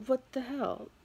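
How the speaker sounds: jolted awake and spooked; retold startled reaction. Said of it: "And I'm like".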